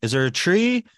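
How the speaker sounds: loud, high exasperated question; retold upset. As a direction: re-enact being upset, voice jumping up. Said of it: "I was getting upset".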